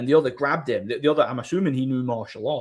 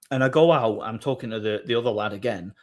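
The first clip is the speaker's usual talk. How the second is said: ordinary talk, nothing marked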